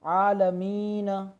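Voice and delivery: slow आलमीन with the long आ held; stretched vowel. Shown as a demonstration. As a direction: hold the आ of आलमीन for two beats. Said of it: "खींच कर पढ़ें". (आलमीन का आ खींचकर)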